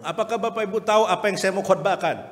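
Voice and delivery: questioning preacher. Retold or imitated. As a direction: ask the congregation pointedly. Pendeta bertanya pada jemaat. (pendeta bertanya dengan suara lebih tinggi dan keras)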